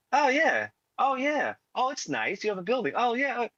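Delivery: high-pitched mock tourist approval; impressed visitor. Visitor shown Brooklyn by a local, mock impressed. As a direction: imitate an awed newcomer in a high voice. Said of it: "you'll be like".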